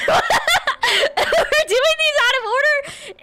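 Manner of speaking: high, slow words through real laughter; laughing. Real, not acted. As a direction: break up laughing while talking. Laughs at muddled order of redeems.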